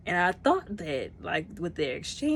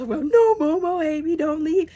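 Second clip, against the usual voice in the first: high, loud wailing plea; mock sobbing plea, much higher and louder; imitating a crying plea; 11 semitones higher, 10 dB louder than usual. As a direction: cry out for Momo not to leave. Quoting the crying scene.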